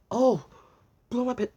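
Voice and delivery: plain reading voice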